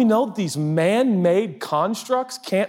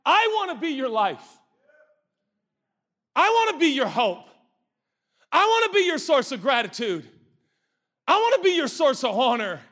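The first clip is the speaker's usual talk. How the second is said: higher, tender voice of God; God gently offering himself; God speaking; 9 semitones higher than usual. Pretend. Preacher voicing God longing to be their life, higher tender voice. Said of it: "God's like".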